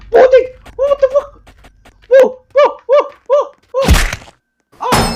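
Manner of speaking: very high, loud screams; terrified screaming. Real, not acted. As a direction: scream in terror. Chased, screaming in fright.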